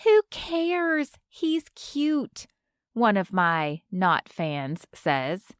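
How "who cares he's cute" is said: put-on high, flippant young fan; dismissive fan. A narrator's reading. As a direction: toss it off, pitched up.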